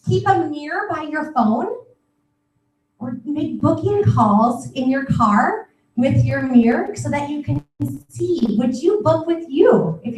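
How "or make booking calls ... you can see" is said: ordinary delivery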